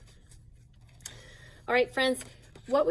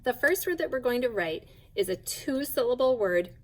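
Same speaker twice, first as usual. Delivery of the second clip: normal talking voice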